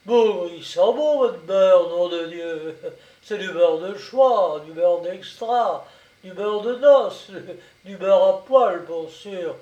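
loud, hearty, relishing mockery; sarcastic brigadier. A narrator's reading. Brigadier mocking the woman's so-called butter, loudly sarcastic. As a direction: pile up the ironic praise with gusto.

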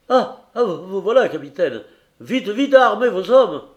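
high, loud, stammered alarm; panicked urging. Acted. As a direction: urge the captain to arm his men. Héros alarmé presse le capitaine. (affolé, crie l'alerte au capitaine)

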